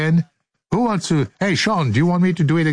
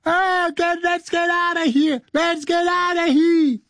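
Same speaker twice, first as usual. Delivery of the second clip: much higher panicked yell to flee; imitating Sam yelling. Sam in the burning hall, high panicked yell. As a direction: cry out in mock terror to get out. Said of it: "you can hear Sam yelling".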